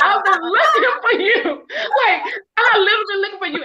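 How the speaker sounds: high, laughing, choked-up plea; emotional high gush; tearful joy. Retold or imitated. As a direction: tell them you searched for them. Retelling joyful first meeting.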